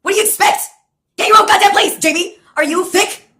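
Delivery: telling off the ex about her housing; retold exasperated scolding. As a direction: berate her as if she's dense. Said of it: "And then I say to Jamie".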